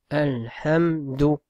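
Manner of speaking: "Alhamdou" drawn out slowly; slow blended word. Shown as a demonstration. Teacher assembling the word for students. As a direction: stretch "Alhamdou", joining every sound. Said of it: "ça fait ou".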